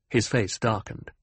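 ordinary delivery, nothing marked

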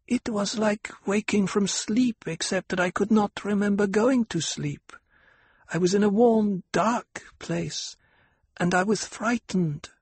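lightly voiced little girl, high and slowed; half-lisping child. A narrator's reading. Aliyah telling her mother, lightly childlike and high. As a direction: tell it like a small child. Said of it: "half-lisping voice of her daughter".

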